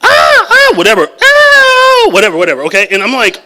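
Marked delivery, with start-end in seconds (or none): 0.0-0.7 short high-pitched mock screams; daughter's night scream, imitated high and loud. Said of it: "she wakes up in the middle of the night screaming". 1.2-2.1 short high-pitched mock screams; daughter's night scream, imitated high and loud. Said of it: "she wakes up in the middle of the night screaming".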